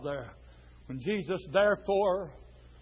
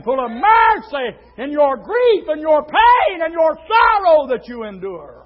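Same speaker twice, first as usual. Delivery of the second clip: loud, swelling into shouted peaks; impassioned preaching; 6 semitones higher, 10 dB louder, slower than usual. Real, not acted. Preacher assures flock of mercy in sorrow, impassioned preaching. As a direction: press it home with full voice.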